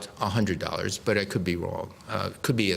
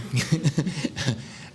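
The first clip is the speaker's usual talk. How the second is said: wry laugh; 4 semitones higher than usual. Real, not acted. Joke about being Secretary.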